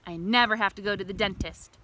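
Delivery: plain reading voice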